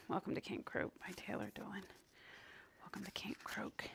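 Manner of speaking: reading in a hushed whisper; too soft, barely voiced. Real, not acted. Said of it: "add some volume".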